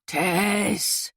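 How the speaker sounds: husky, drawn-out name. Acted. John answers Tess, husky and drawn out. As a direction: let the voice roughen on her name. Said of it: "he said huskily".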